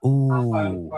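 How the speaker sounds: a drawn-out mock crowd ooh; audience groan. Podcaster describing social signalling, mock crowd ooh. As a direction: wince like a comedy audience. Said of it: "that's what that sound is".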